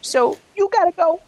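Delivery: smooth flashy pimp swagger; high, slick pimp come-on. Retold or imitated. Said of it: "That was my pimp voice".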